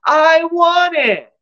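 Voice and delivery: put-on a spoiled little girl screaming for a toy; screaming child. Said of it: "hears the child scream".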